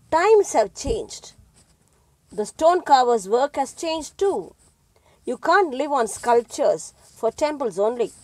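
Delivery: impatient son. A narrator's reading. Son urging father to modernise, loud and impatient. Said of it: "the young man grunted impatiently".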